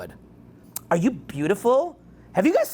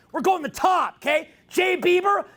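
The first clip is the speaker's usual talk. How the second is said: mock hype; 8 semitones higher than usual. Hyping his mock hit song.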